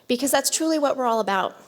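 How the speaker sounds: ordinary talk, nothing marked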